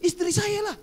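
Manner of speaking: high-pitched mock retort to the wife. Preacher answers wife about sermon stories, retorting. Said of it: "saya bilang sama dia".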